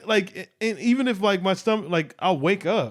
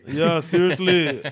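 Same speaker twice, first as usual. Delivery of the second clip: put-on slow Irish accent echo; slower than usual. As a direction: copy his Irish accent slowly. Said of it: "solid Irish accent".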